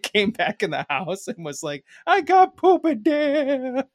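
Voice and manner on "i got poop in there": put-on high, slow, crying child; whiny toddler through laughter; little girl voice. Niece quoted after accident.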